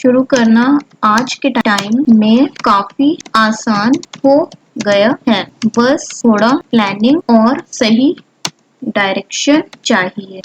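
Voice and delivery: slow, word by word dictation; slow typing-along dictation. Acted. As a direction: speak each word slowly as you type it.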